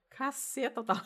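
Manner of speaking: ordinary talk, nothing marked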